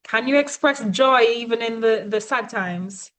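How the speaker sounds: usual speaking voice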